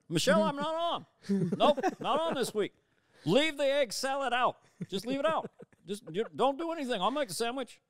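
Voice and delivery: hammed-up Obama yelling to Michelle; Obama impression. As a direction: Obama whining to his wife. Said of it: "my Obama".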